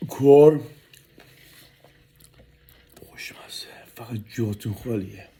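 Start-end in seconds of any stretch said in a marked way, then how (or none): 3.1-5.3 softer whispered praise. Real, not acted. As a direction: hush it between bites. Whispering after tasting.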